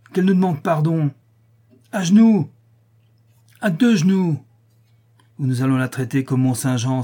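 furious chanting crowd; mob shouting. Acted. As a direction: shout her down to her knees. Mob demands kneeling apology.

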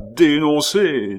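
slow, high, loud mocking echo; sinister irony. A narrator's reading. Abbé scoffing at Pitou's threat, sinisterly ironic. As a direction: draw it out with an ironic laugh. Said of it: "rire d'une façon sinistrement ironique".

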